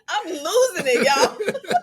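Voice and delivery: can't stop laughing. Real, not acted. Laughing at the memory.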